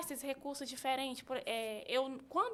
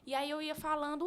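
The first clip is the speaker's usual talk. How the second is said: normal talking voice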